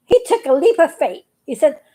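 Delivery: ordinary delivery, nothing marked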